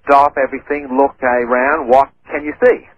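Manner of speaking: normal talking voice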